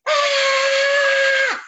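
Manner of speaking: a big shouted Ah; loud yell. Shown as a demonstration. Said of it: "you have to yell really loud".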